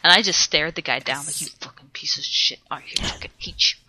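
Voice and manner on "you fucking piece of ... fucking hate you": menacing hateful whisper. Retold or imitated. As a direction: hiss it under your breath. Staring a rival player down.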